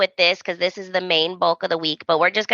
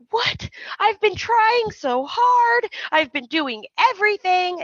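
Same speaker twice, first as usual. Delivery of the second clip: high, drawn-out whine, imitating tears; hammed-up tearful wife pleading; mock crying; 12 semitones higher, slower than usual. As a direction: wail it up high without shouting. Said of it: "[name] is crying".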